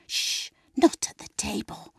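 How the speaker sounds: hushed unvoiced whisper at the table. A narrator's reading. Mother hushing Leslie at the dinner table, whispered. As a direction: hiss it under the breath so no one hears. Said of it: "whispered Mother".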